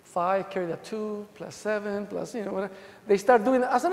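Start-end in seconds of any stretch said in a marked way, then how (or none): 0.0-2.7 mock mumbled counting. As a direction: mumble like a cop doing sums under his breath. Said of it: "This is what they do".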